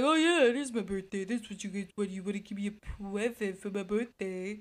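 low, soft dopey voice; dumb principal act. Acted. Oblivious principal angles for a birthday present, dopey. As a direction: play it dim and oblivious, voice dropped low. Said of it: "playing the principal so dumb".

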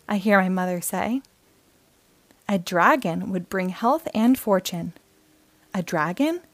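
gentle storybook read-aloud; friendly picture-book narration for kids; reading to children. A narrator's reading. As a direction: read it like bedtime story time.

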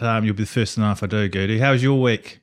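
ordinary delivery

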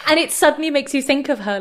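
ordinary delivery

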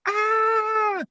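squeaky high startled cry; mock frightened yelp. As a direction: play the crowd's panicked little gasp. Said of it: "And people are like".

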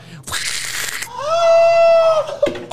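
very high drawn-out comic wail; high mock scream. Australian hit by repellent, high hammed-up scream. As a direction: wail as if being zapped away. Victim vanishing under repellent.

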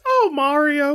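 high, sighing "Oh, Mario"; exasperated sigh. Retold or imitated. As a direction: sigh it out like a fed-up princess. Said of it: "sighs and says".

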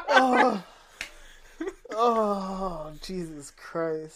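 cracking up; laughing hard. Real, not acted. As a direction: laugh through the words.

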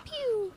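high soft pew gunshot sound; imitated gunshot. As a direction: make a little pew for a gunshot. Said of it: "governor comes over out of nowhere".

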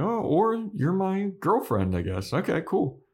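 high-pitched sheepish hedge, dipping on the aside. Acted. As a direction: act out an awkward, sheepish boyfriend. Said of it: "sheepish in this".

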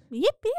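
high yipping call; squeaky high yip yip yip; zebra yip. Retold or imitated. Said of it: "That's like what zebras make".

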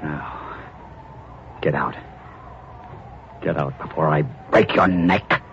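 low, slow threat; quiet, deadly menace; low menacing order. Acted. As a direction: growl the threat, slowly. Husband orders wife out.

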